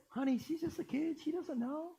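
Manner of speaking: high, soft, sweetly reasoning wife; lightly voiced wife calming her husband; wife's voice. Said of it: "And she said".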